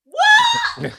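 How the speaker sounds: very high, loud shriek of disbelief; shocked shriek. Retold or imitated. Told she is cast on TV, high shocked shriek. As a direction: squeal it like the news just knocked you over.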